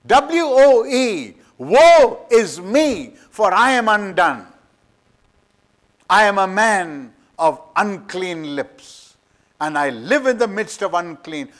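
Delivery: loud, high, impassioned sermon voice; thundering pulpit preacher; full-force preaching. Acted. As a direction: preach it with rising fire. Preaching the vision of Isaiah.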